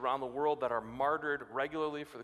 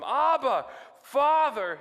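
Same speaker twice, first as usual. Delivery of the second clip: loud, high cry to Father; pleading cry; 8 semitones higher, 8 dB louder, much slower than usual. Retold or imitated. Jesus praying in the garden, loud high cry. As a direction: cry out in anguish. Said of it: "he said".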